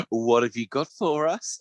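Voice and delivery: slow, drawn-out imitation of a kid; knowing child. Daughter who has clocked dad's trick, knowingly expectant. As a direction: ask expectantly, stretching the words. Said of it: "Daisy's a bit too smart".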